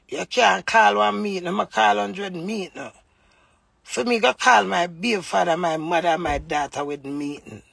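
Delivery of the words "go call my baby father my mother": usual speaking voice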